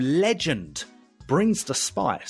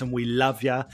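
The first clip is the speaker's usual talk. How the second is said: ordinary talk, nothing marked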